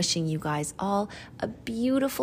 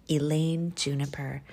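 ordinary delivery, nothing marked

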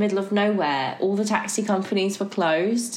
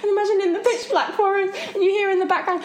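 higher voice, laughter breaking in; laughing through the words; 11 semitones higher, faster than usual. Real, not acted. Recalling the scary forest walk to her friend, laughing. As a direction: giggle as you set the scene.

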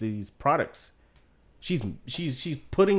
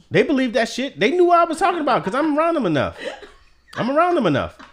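laughing insistence; 13 semitones higher, 7 dB louder than usual. Real, not acted. Defending his accent, laughing.

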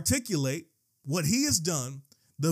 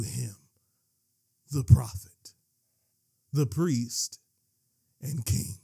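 low, spaced-out solemn phrases; low voice after the shouted climax; sudden low drop; 6 semitones lower than usual. Real, not acted. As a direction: drop low and pause between titles.

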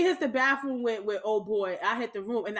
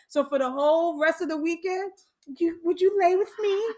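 high-pitched mock pleading; teasing imitation; 7 semitones higher, 5 dB louder than usual. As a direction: mimic the lovesick ask, pitched up. Friend teasing her with his request.

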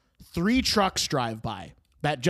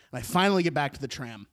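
ordinary talk, nothing marked

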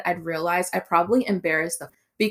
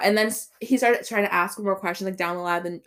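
ordinary delivery

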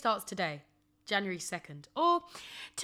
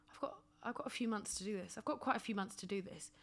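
whispering; 10 dB quieter, faster than usual. Retold or imitated. Imagined complacent inner voice.

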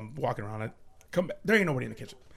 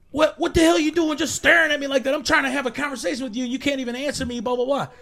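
stepdad's irritated scolding; 9 semitones higher than usual. Retold or imitated. Stepdad yelling at the figure.

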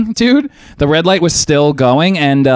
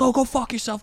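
high, softer mock yell; retold angry insult; 10 semitones higher, 6 dB quieter than usual. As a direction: imitate the driver cursing back, pitched up. Driver's insult in retold quarrel.

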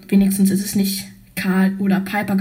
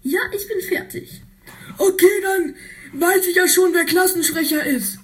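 smug character voice; 8 semitones higher, faster than usual. Acted. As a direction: crow it out, sure of the win.

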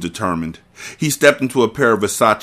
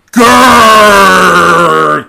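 high, loud animal growl-yell; furious yell; 9 semitones higher, 11 dB louder than usual. Acted. Carter firing past her head, a loud growling yell. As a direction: roar it out as you squeeze the trigger. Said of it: "Carter yelled as he pulled the trigger".

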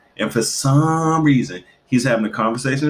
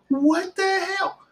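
very high, slow what the hell; shocked; slower than usual. Retold or imitated. Mother reacting to her son's swearing, very high shock. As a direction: stretch it in outrage.

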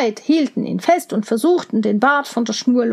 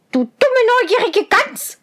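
hohe, zänkische Zwergenstimme; zänkischer Zwerg; 10 semitones higher, faster than usual. A narrator's reading. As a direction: giftig schimpfen wie ein Zwerg. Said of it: "antwortete der Zwerg". (Zwerg beschimpft Rosenrot, hoch und zänkisch)

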